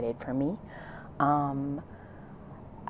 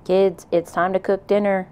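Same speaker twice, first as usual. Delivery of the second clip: louder call up the stairs; hollered; 4 semitones higher, 6 dB louder than usual. A narrator's reading. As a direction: holler to the kids upstairs, a bit higher.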